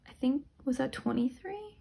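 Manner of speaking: usual speaking voice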